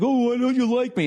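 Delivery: high, whiny mock hurt; exaggerated high-pitched wounded whine; mock wounded pleading. Mocks response she was expecting.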